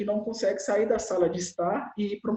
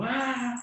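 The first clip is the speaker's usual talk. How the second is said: high playful growl of anger; mock angry "ah!"; 6 semitones higher than usual. Shown as a demonstration. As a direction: pretend to roar at a child. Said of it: "Você pode estar muito bravo". (grito de bravo de brincadeira)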